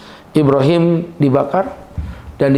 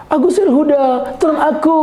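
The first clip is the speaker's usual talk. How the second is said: high, pleading mock shout with a laugh; comic high-pitched plea for rescue; mock cry for help; 10 semitones higher than usual. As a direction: wail for help, hammed up. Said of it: "Terus saya teriak gini".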